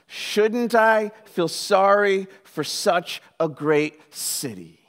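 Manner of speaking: very slow, weighted question as God; grave, deliberate divine plea; solemn appeal. Acted. God's closing question, read dramatically.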